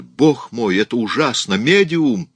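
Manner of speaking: high cry building in alarm; exclaim, peaking loudly on the last word; horrified shout. A narrator's reading. Raoul cries out for the medium, horrified. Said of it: "кричал Рауль".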